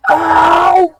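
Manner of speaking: very high, loud squealed yelp; yelp of pain. Acted. Caught by her head, a loud high-pitched pained squeal.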